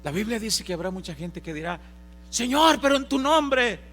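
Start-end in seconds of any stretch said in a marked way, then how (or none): 2.3-3.8 loud, high plea; pleading cry. Acted. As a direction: call out to the Lord, high and loud. Gente suplicando al Señor. (clamor suplicante, alto y fuerte)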